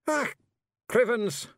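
high, loud Scots oath; Feegle curse. A narrator's reading. Rob Anybody gives up in a high, loud Scots oath. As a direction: spit out the curse, giving in. Rob swears, throws down sword.

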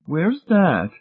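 high-pitched doubtful question. A narrator's reading. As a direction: ask it doubtfully in a high lady's voice. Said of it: "Missus Pritchett asked doubtfully".

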